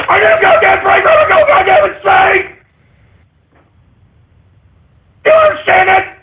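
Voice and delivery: hammed-up meltdown yell; furious shout. Real, not acted. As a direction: blow up, screaming for a break. Rant boils over at panda.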